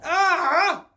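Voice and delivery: loud, high exasperated laugh-shout; frustrated laughing yell. Real, not acted. Vlogger giving up on the noise, loud laughing yell. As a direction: let out a loud fed-up laugh.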